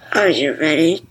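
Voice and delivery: ordinary talk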